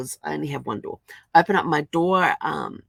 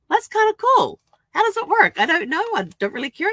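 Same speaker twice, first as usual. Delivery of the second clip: much higher, louder, playful inner voice; imitating her younger self's thoughts; 13 semitones higher, 7 dB louder than usual. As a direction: play your curious younger self shrugging it off. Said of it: "And I thought".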